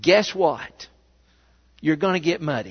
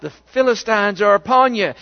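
yelled warning; 4 semitones higher, 7 dB louder than usual. A narrator's reading. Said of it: "she yells out".

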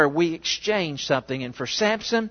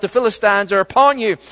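loud cry, a touch higher; yelled warning; 4 semitones higher, 7 dB louder, faster than usual. A narrator's reading. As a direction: call out the Philistines' warning. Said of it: "then yells out".

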